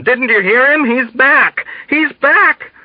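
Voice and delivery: high, frantic insistence; panicky, urgent shouting; shouted alarm. A narrator's reading. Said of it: "someone shouted at him".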